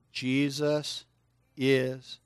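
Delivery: drawn-out words with gaps between; word-by-word prompt. Shown as a demonstration. Preacher cueing kids to join in, word by word. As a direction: lead them one word at a time.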